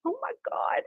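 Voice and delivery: high, hushed scandalized gasp of words; imitated shock. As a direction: mimic a mortified sister. Sister shocked at mother's request.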